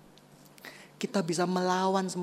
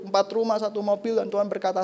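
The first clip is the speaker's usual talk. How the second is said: normal talking voice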